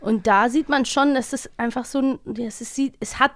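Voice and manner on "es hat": usual speaking voice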